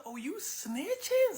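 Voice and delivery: high, slow indignant question; pissed. Retold or imitated. Hush Puppy confronting the partner who betrayed him, pissed. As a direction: stretch it out in mock outrage. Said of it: "Hush Puppy's pissed".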